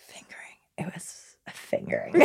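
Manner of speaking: hushed, whispered delivery; mock ASMR whisper. Dancer joking to co-host, mock whisper. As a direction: whisper it close, as joke ASMR.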